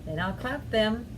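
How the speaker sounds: put-on slow, measured children's rhyme; rhythmic recitation with pauses for the motions; finger-play rhyme. Shown as a demonstration. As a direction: lead little ones through the actions, stretching key words.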